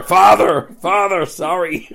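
loud, slow rural fix of the word; West Country Vader correcting himself. Retold or imitated. Friend mocking the actor's accent, slow loud correction. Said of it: "he used to have the nickname Darth Farmer".

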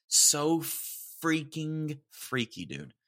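drawn-out spooked emphasis. Real, not acted. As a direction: stretch it out, creeped out. Reacting to the freaky story.

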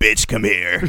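mock drill instructor rasp; strained instructor voice. Instructor summons a new recruit, in a strained mock rasp. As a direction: imitate a worn-out instructor's bark. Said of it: "why is his voice like that?".

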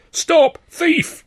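very high, loud shout of alarm; crowd cry. A narrator's reading. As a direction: yell it at a fleeing thief. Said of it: "came the cry from behind".

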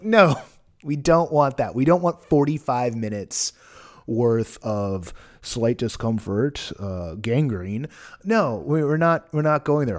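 loud, emphatic refusal. Real, not acted. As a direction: firmly shoot the idea down. Emphatic rejection of ad tone.

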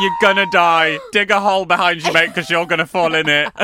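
loud, gleeful warning; bubbling over with a surprise; excited, near laughter. Real, not acted. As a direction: burst out loudly, on the edge of laughing. Hysterical excitement about the gift.

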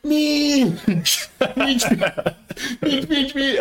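very high-pitched repeated holler; mock far-away yell breaking into laughter; Jake. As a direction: imitate gleefully yelling a silly word across campus. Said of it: "a hundred and fifty meters away".

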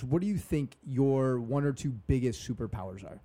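ordinary talk, nothing marked

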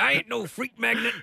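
Booker T impression. Voicing Booker T.

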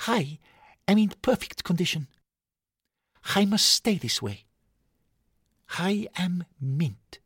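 high, prim toy voice, each word held carefully; a fussy collectible guarding his mint condition; precious Lego figure. Acted. Lego Gerard refusing to help, in a high, prim voice.

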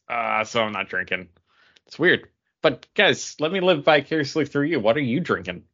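ordinary delivery

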